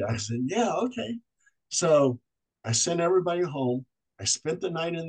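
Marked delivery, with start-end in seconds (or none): 0.5-1.2 high-pitched eager agreement; retold excitement. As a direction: replay an excited yes, voice lifted. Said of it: "I was all excited".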